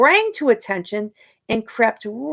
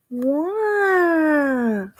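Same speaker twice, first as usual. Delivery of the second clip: put-on high wavy baby wail; baby cry; 6 semitones higher than usual. Shown as a demonstration. Teacher showing the children how the baby cried. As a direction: show the wave of a baby's cry. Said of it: "how the author wrote the sound".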